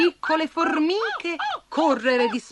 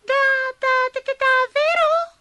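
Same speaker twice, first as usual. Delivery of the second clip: high, stuttering astonishment; can't believe the praise; stammering disbelief; 6 dB louder than usual. Acted. Abigail stammers, amazed at Gambi's praise.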